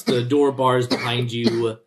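normal talking voice

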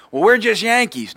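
high, loud mimicry of defensive Yankees; mock excuse. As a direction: pipe up loudly with a put-on excuse. Mimics Yankees excusing their rudeness.

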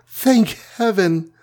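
high, slow cry of relief; a touch of a sob, pitched high and drawn out; sobbing. A narrator's reading. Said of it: "sobbed the Wasp".

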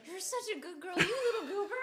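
put-on high, cooing baby talk to a pet; dog voice. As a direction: coo at your little dog. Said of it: "How would you speak to her?".

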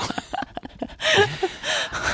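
breathless, squeaky giggle; high squeaky laugh. Real, not acted. Laughing at the joke.

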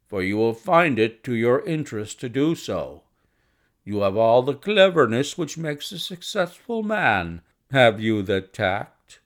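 loud, businesslike gentleman caller; blunt visitor sizing up a young doctor; brusque visitor. Acted. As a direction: play a forthright man plunging into business. Narrator voices the visitor Blessington.

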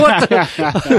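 high, loud, laughing what the; laughing disbelief. Retold or imitated. Reacting to the film's phone call, high laughing disbelief. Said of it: "It's like".

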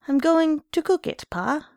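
put-on high child voice; little boy. A narrator's reading. Little son promising his father dinner, childlike. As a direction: placate Pa as a small boy. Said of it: "said the little boy".

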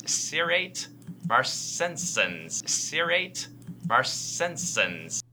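Cerate marcescens said as SEER-ate mar-SEN-senz; mispronounced. Real, not acted. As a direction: say Cerate marcescens slowly, wrong. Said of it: "Let's now listen to [name]'s pronunciation".

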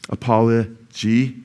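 apology drawn out slowly; slow demo. Shown as a demonstration. Preacher slowly modelling apology for his congregation, slow. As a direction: stretch apology out slowly for the crowd. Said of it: "Very easy, apology".

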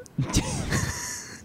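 shrill burst of laughter; high squealing laugh. Real, not acted. Laughing at Cajun bit.

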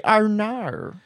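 high mock Australian "our nar"; Australian accent. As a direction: stretch it into exaggerated Australian. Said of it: "But they say".

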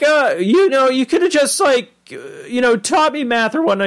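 very high, hesitant boy impression; squeaky, halting teenage complaint; Morty. Boy asks why not just teach math, high and halting. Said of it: "I love how Morty's all like".

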